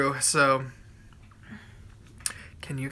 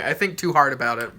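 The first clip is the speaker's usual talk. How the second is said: ordinary talk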